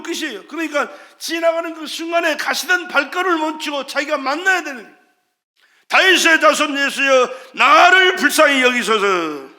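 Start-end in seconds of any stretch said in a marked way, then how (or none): 5.9-9.5 very loud, drawn-out shout; crying out. Retold or imitated. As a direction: belt out a desperate plea for mercy. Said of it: "그렇게 소리를 질렀더니".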